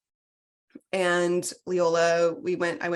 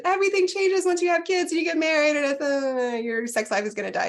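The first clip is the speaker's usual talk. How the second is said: mocking what people say; 9 semitones higher than usual. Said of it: "They tell you".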